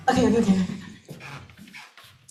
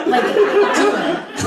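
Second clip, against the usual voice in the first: laughing urge; 9 semitones higher, 6 dB louder, much slower than usual. Real, not acted. Laughing urging.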